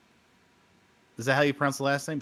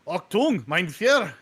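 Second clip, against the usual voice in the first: put-on German accent; slower than usual. Joking to his cohost in a put-on German accent. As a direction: bark it like a mock German officer. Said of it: "what'd you think of my German accent".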